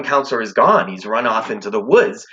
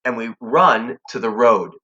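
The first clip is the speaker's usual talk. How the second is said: normal talking voice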